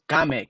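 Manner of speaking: "kame" as KAH-meh, two syllables; spelling pronunciation. Shown as a demonstration. Questioning how the spelling gives came, sounded out. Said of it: "How is kame came".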